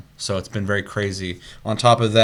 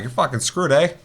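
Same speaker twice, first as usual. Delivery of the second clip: mock Canadian; 6 semitones higher than usual. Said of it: "apologize ahead of time to any Canadians".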